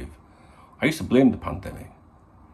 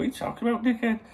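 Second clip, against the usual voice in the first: mock tough-guy retort. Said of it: "I look at it and go".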